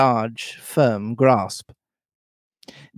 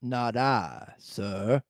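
much softer, meek sailor; Morgan; 10 dB quieter, much slower than usual. A narrator's reading. Old sailor answering his stern captain, quiet and deferential. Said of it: "And then we get Morgan".

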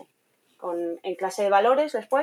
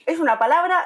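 emphatic protest; 8 semitones higher, 6 dB louder than usual. Retold or imitated. Teacher protesting a colleague's correction.

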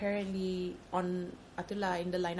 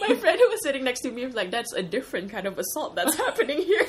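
laughing; 5 semitones higher, 7 dB louder than usual. Real, not acted. Quoting friend's joke.

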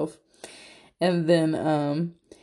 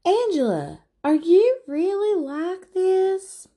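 very high, slow, incredulous voice; mock disbelief; 5 dB louder, slower than usual. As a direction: drag it out in shocked disbelief. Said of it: "and Rebecca's like".

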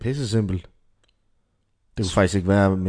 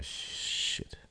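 hushed, whispered curse; whispered dread; 15 dB quieter than usual. Acted. Munke Lars thinks to himself after his orders, in a dismayed whisper. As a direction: whisper it as a private thought. Intimidated Munke Lars's acted thought.

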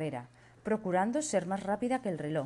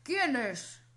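king voice; 7 semitones higher than usual. Acted. King asks his son.

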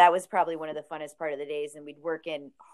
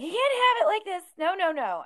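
exasperated protest; 14 semitones higher, 5 dB louder than usual. Retold or imitated. Retold protest at messy records.

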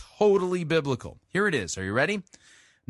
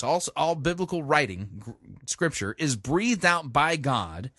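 normal talking voice

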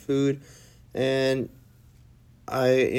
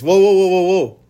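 imitating coworker's alarm; 7 semitones higher, 11 dB louder than usual. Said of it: "He goes".

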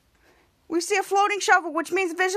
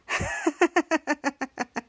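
long staccato cackle; mock evil "ha ha ha"; acted villain laugh. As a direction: gloat with a drawn-out pretend laugh. Villain laughs while burying them.

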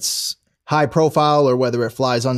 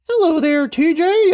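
high-pitched mock hello, a bit louder; playful falsetto hello to a figurine; squeaky high greeting; 3 dB louder than usual.

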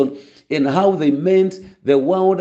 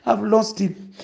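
mourning mother imitated; 7 semitones higher than usual. Pastor imitating a mother mourning her son, high wailing lament. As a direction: imitate a grieving mother crying at the grave. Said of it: "you know how people cry and they mourn".

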